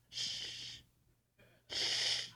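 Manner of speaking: long unvoiced shh; imitated churchgoer's hush; shushing. Quiet congregation silencing the chatty visitor, imitated shushing.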